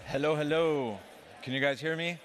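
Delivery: usual speaking voice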